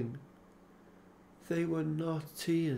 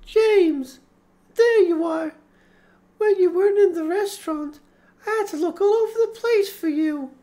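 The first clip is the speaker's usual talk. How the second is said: put-on high, bright, quick cheerful girl voice; 12 dB louder than usual. A narrator's reading. Laura greets James after searching everywhere, cheerful and quick. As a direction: bounce in happily, quick. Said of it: "She spoke in a cheerful voice".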